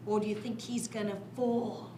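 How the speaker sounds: ordinary delivery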